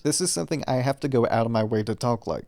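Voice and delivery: ordinary delivery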